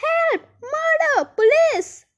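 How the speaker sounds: very high, loud alarm cries; screaming. A narrator's reading. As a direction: scream each word as an alarm. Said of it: "screamed Miss Gloop".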